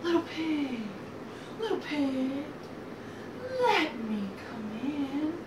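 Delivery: drawn-out wolf call at everyday pitch; restrained wolf call. Acted. Wolf at the brick house door, restrained slow call. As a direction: call it slowly, holding back the volume.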